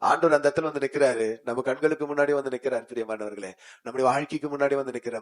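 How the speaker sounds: ordinary delivery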